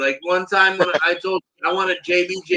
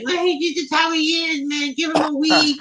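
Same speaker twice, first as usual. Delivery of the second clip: much higher-pitched mocking voice; mimicked other guy; 9 semitones higher than usual. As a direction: imitate him in a high put-on voice. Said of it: "He's like".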